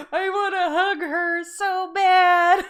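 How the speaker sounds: loud, high, drawn-out mock wail; imitated crying. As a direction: wail it slowly, playing up the sympathy tears. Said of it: "I was, like, crying with you".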